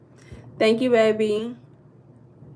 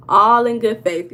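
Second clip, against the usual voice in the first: louder repeat; 10 dB louder than usual. Shown as a demonstration. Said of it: "It's all in good faith".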